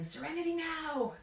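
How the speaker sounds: loud, high-pitched exasperated-dad yell; sudden sitcom-dad outburst, loud and high; George Costanza's dad yelling. Acted.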